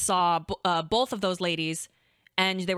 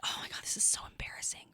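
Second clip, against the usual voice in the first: fast, breathless whisper of embarrassment; whispering so only you hear it; embarrassed whisper; 11 dB quieter, faster than usual. Retold or imitated. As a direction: hiss it out under your breath, mortified. Mortified aside to hygienist.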